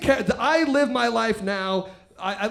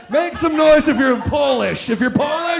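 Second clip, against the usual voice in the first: loud, high shout to the crowd; raised-voice call for cheers; 5 semitones higher, 6 dB louder than usual. Real, not acted. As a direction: hype the audience up. Calls out to the crowd.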